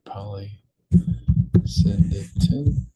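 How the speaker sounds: "polysyndeton" said slowly, syllable by syllable: po-ly-SYN-de-ton; drawn-out syllables. Shown as a demonstration. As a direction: spell out "polysyndeton" by ear, very slowly. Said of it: "let's just add this word".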